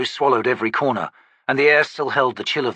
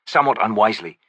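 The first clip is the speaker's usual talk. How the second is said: ordinary delivery, nothing marked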